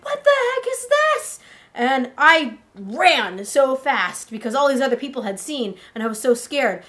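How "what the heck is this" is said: high-pitched acted shriek of disbelief; screamed shock. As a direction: squeal it as if startled by something impossible. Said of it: "and she screams".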